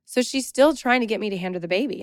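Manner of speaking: ordinary talk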